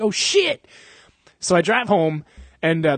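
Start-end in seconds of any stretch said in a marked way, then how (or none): 0.0-0.7 high, sudden startled "Oh, shit!"; startled yelp. Retold or imitated. Driver hearing metal shear under his brakes, startled high yelp. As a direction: jolt of alarm at a grinding brake.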